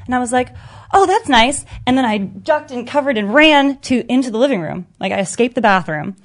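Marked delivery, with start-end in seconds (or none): 0.9-1.6 mock cheerful. Reply to beating threat.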